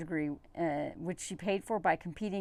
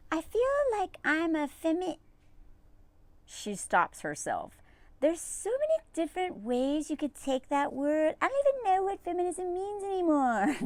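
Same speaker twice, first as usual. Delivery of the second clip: high, pitched-up imitation of a hesitant wife; mock trad wife; 9 semitones higher than usual. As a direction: voice her higher and dodging the question.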